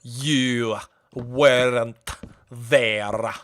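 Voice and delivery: very slow, brooding sea captain; Davy Jones impression. Doing Davy Jones for chat, very slow brooding pirate. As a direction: stretch every word with menace. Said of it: "I had a Davy Jones in the chamber".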